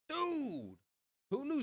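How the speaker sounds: high, drawn-out exclamation; astonished exclamation. Real, not acted. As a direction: cry out in disbelief, pitch dropping at the end. Astonished at finding the word.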